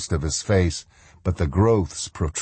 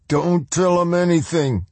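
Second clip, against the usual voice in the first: shouted; 12 semitones higher than usual. Acted. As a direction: yell a warning at your comrade. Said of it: "the man on the ground shouted".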